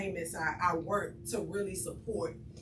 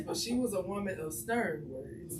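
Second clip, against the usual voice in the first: ordinary talk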